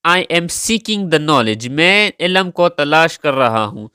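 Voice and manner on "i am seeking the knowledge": very slow, careful example sentence; slow model sentence for learners. Shown as a demonstration. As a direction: lay out each word of the example slowly. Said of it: "सीक".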